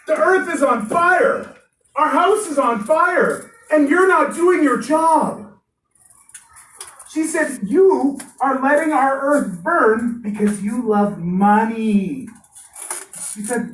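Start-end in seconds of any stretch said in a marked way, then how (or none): 0.1-5.4 much higher, louder scolding; angry speech. Acted. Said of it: "She got mad and she yelled". 7.6-12.3 louder, slowed accusation; accusing speech. Acted. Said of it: "She got mad and she yelled".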